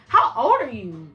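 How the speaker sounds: slow, drawn-out disbelieving question; incredulous questioning. Retold or imitated. Asking the drunk girl her age, slowly incredulous.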